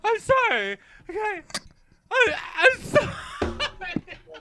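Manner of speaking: high squealed sorry through laughter; cracking up, apologizing over and over; laughing apology. Real, not acted. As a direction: giggle helplessly while apologizing. Host breaks down laughing apologizing.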